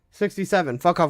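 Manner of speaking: ordinary talk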